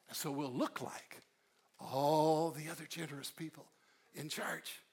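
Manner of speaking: a couple scheming in hushed voices; scheming conspirators. Pretend. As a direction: whisper the plan like co-conspirators.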